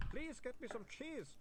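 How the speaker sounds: high, slow pleading voice of a shaky vampire; a frantic vampire clerk begging for cheese; desperate vampire. A narrator's reading. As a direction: beg for food, slow and high.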